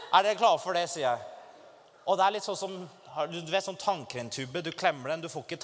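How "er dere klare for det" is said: shouted out to the crowd; a preacher's loud call to the crowd; rallying shout. Retold or imitated. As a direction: fire up the whole room.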